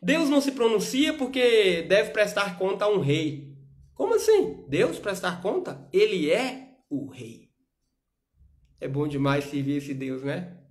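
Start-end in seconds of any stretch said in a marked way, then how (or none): 0.0-3.6 rising, louder preacher's build, slowing at the end; fiery pulpit exhortation swelling up; preaching. Acted. Preaching, incredulous peak. 3.9-4.7 sharp, very high mock disbelief; preacher's outraged what; incredulous. 4.7-7.5 rising, louder preacher's build, slowing at the end; fiery pulpit exhortation swelling up; preaching. Acted. Preaching, incredulous peak.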